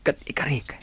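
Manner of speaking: hushed, intimate promise; whisper. Acted. Suitor whispering his promise to her. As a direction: say it just for her ear. Confessing love secretly.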